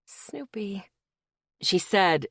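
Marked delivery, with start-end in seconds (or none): 0.0-0.8 a faint, breathy name; almost no voice left; barely there. A narrator's reading. Faint voice naming herself.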